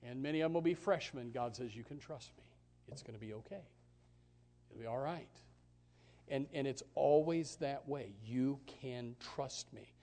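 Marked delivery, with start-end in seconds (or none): 1.7-5.4 soft voice of God. Acted. God's reassuring voice. 8.2-9.9 slow, soft voice of God. Acted. God's reassuring voice.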